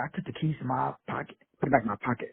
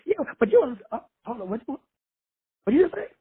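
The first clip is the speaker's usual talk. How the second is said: higher, halting disbelief building to a high what did you say; stunned caller; 7 semitones higher than usual. Retold or imitated. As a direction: caller caught off guard mid-argument. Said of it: "'cause they still arguing".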